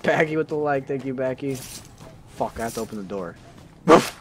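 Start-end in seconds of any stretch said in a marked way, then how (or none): 3.9-4.1 short, repeated woofs; desperate dog bark. Pretend. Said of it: "It's getting more desperate".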